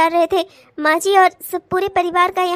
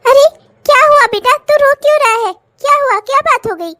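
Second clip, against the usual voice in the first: put-on higher, louder worried old mother; mother calling to her son in alarm; 7 semitones higher, 7 dB louder than usual. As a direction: rush in asking what's wrong. S1 plays the mother.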